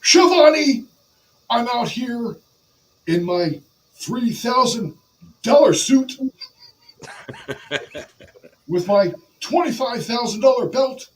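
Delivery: high, loud bragging wrestler; Ric Flair. Acted. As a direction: boast in bursts at a high pitch. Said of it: "I can't talk like Ric Flair".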